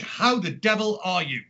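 ordinary talk

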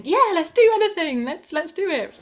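put-on high, loud burst of childlike enthusiasm; eager kid. Flute player recalls any kid offered piano lessons, eager and high-pitched. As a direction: jump at the offer like a keen kid. Voicing an eager kid's answer.

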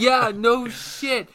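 loud, high, slow laughing agreement; real laughing yell; laughing shout. Real, not acted.

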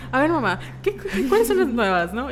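high, loud eager pleading to mom; eager gossip request. Retold or imitated. Daughter begging mom for gossip, high and eager. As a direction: beg mom for the latest gossip.

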